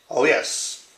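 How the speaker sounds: mock British accent, slow and measured; bad British accent. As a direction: put on your worst British accent. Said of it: "that's my worst British accent".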